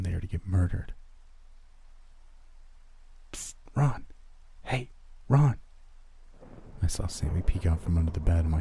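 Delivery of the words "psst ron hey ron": put-on high, secretive child voice; little brother's hushed call. A narrator's reading. Little brother hiding under the bed, hushed urgent call. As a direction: call furtively, pitched well up.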